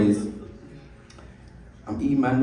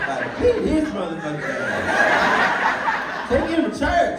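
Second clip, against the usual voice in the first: laughing; 6 semitones higher, much slower than usual. Real, not acted. Laughing while telling.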